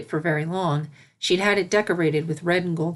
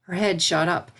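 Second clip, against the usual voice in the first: ordinary delivery, nothing marked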